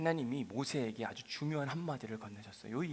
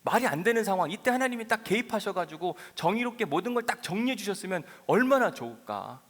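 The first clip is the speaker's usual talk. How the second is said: arguing with God; 6 semitones higher, 6 dB louder than usual. Acted. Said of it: "하나님께 따지는 거예요".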